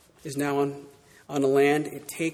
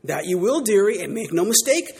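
Missus Beaver; 4 semitones higher, 6 dB louder than usual. Acted. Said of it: "said Missus Beaver".

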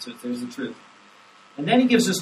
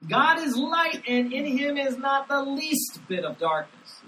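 much higher, slow, ringing; emphatic proclamation; 10 semitones higher, slower than usual. Shown as a demonstration. Pastor proclaiming the verse, lifted high and slow. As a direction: proclaim it as the heart of the passage. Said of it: "is the emphasis of the passage".

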